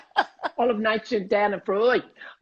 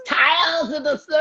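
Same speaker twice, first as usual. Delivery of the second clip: imitating Australian accent; 6 semitones higher than usual. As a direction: mimic a friend's Australian accent, hammed up. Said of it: "Tales of the City in my accent and yours".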